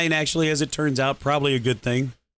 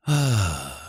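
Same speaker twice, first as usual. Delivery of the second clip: heavy sigh; 5 semitones lower than usual. Real, not acted. Drained after losing job.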